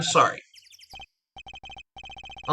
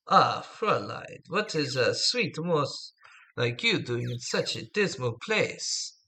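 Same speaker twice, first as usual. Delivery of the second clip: attempted German accent; 7 dB quieter than usual. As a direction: croon it slowly in a rough German accent. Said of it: "I can't do a good German accent".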